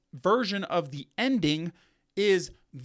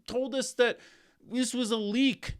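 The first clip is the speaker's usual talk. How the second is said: whiny complaint; 5 semitones higher than usual. Retold or imitated. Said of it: "they're gonna complain like".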